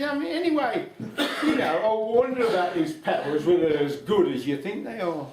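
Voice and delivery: high, loud and chuckling; laughing old lady. Acted. Old lady laughing at the devil's powers, high and amused. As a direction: tease him through a laugh.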